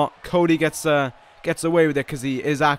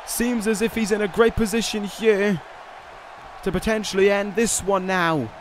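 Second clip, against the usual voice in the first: excited commentator; 6 semitones higher than usual. Acted. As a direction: build the hype on the finisher. Match climax, rising commentary.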